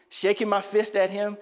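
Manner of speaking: ordinary talk, nothing marked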